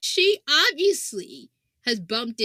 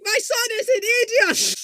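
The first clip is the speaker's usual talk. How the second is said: ranting mother; 7 dB louder than usual. Pretend. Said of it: "it's just being like".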